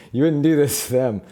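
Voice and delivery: loud, high protest; acted indignant appeal. Fixer appealing to rival gang's code, indignant. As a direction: call them out on the old code.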